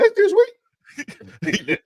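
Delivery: squeaky mock-excited replay; high, excited voice. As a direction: mimic your own excited squeak, drawn out into laughter. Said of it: "how my voice got high".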